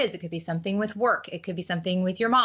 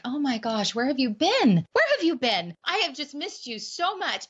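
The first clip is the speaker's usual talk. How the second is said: overjoyed reunion; 7 semitones higher than usual. Retold or imitated. A mom to her grown daughter, delighted, gushing welcome. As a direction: imitate a mom thrilled to get her daughter back. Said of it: "and you're like".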